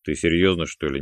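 ordinary delivery

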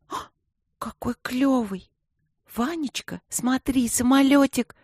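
ахни и протяни высоким девчачьим голосом; мягкий высокий восторг, зови малыша посмотреть; восхищённое удивление. A narrator's reading. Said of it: "воскликнула она". (негромкое восторженное восклицание)

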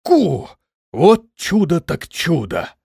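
high, drawn-out wonder; amazed drawl. A narrator's reading. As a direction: stretch it out in surprise. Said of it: "удивлённо протянул". (удивлённо, нараспев протягивая)

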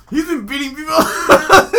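loud, high, badly faked Scottish accent; mock Scottish. Mocking a domestic violence rumour, loud high fake Scottish. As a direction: yelp it in a hammed-up Scottish brogue. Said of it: "I don't know why I'm being Scottish".

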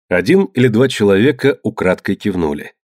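ordinary delivery, nothing marked

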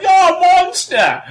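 put-on high, loud drawn-out child's cry; little kid. Little kid accusing the touring actor, high child's shout. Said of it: "some little kid".

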